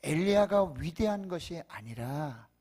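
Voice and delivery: ordinary talk, nothing marked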